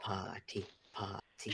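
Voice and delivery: low, hushed character voice; Ansel. Acted. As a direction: softly chant it in a put-on accent. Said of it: "in Ansel's accent voice, too".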